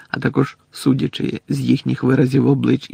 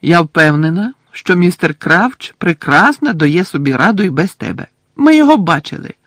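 firm; 5 semitones higher, 6 dB louder than usual. Acted. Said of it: "твердо мовила Герміона".

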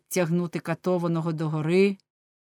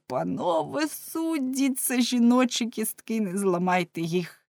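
pained wail; 6 semitones higher than usual. A narrator's reading. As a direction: wail for mercy, then trail off. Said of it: "тільки зойкав". (високий, протяжний зойк, що згасає)

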